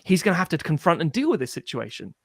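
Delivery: ordinary delivery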